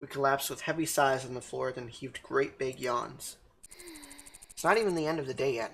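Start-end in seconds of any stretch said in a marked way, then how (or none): 4.6-5.7 rushed, worn-out whine; fast tired complaint. Acted. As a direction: blurt it out, exhausted. Tired after setting up room.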